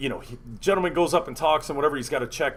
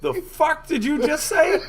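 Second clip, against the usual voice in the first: slow, high-pitched disbelief; mock stunned reaction, voice way up; incredulous; 12 semitones higher, slower than usual. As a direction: draw it out in high, hammed-up disbelief. Retold disbelief at friend's outburst.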